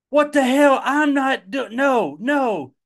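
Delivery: high, loud furious yell; angry shout. Retold or imitated. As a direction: explode at the nurse, shrieking. Daughter's outburst at hospital stay.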